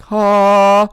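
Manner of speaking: loud, high, drawn-out "haaa"; high, loud mocking cry. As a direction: belt out a long taunting ha. Mimicking a gloating exclamation.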